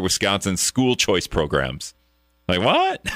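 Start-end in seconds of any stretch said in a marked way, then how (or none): 2.5-3.1 high-pitched, baffled reaction; incredulous. Acted. Host reacting to extras added to the bill, incredulous. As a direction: jump up high in disbelief.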